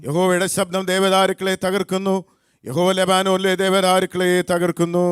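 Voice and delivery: high, loud psalm reading; scripture recitation. Acted. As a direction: proclaim the psalm to lead a congregation. Leads responsive psalm reading.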